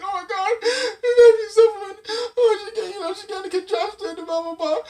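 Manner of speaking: high, loud fretting wail; mock panicked parent. Dad mimicking a frantic parent over baby's cold. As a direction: overdramatic worried parent.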